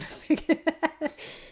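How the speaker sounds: short high laugh after choking; embarrassed laugh. Real, not acted. Mother laughing at herself after choking on camera, embarrassed laugh. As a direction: laugh it off, a bit sheepish.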